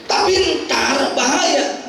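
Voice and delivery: high, drawn-out protest; acted worry, slow and high; worried objection. Worried disciple objects to danger.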